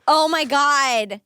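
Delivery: usual speaking voice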